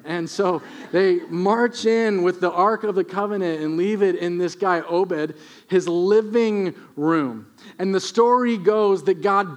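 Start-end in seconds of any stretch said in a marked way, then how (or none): none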